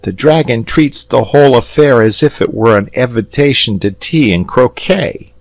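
a lad scornful of his friend; a hint of bitterness; bitter boy. Boy voiced in story.

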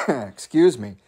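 plain reading voice

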